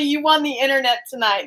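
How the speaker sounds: usual speaking voice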